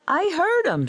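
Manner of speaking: high-pitched, indignant insistence; insistent protest. A narrator's reading. Gold protests against Parsons.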